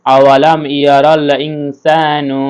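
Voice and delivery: আওয়ালাম ইয়ারাল ইনসানু read slowly and clearly; slow model reading. Shown as a demonstration. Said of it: "teacher models phrase".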